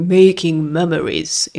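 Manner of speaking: put-on English-accented ad slogan; English accent in Hungarian. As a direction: read it like a cheesy English ad. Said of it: "nagyon ilyen túlzó angol kiejtés".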